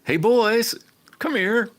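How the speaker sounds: high, loud hammed-up call across the shop; calling the boys. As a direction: holler for the boys to come over. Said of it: "You'll say".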